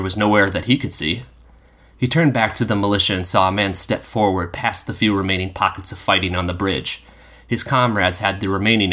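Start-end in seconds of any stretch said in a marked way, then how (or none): none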